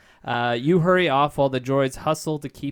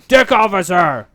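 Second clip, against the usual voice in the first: loud shout across the hangar; yelling; 6 semitones higher, 12 dB louder than usual. Acted. Han yells for the deck officer, loud call. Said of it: "Han yells".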